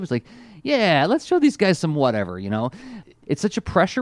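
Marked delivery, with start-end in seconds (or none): 0.6-2.4 lightly imitated cheerful hero, pitched up; a high, upbeat "yeah" in mock cheer. Podcaster quoting Ironhide's arrival, cheerful. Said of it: "he sounds very cheerful when he shows up".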